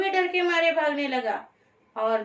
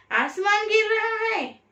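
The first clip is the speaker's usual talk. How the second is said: high, roaring lion's demand to stop; 6 semitones higher than usual. A narrator's reading. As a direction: roar it out like an angry lion. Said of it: "शेर ने जोर से दहाड़ कर".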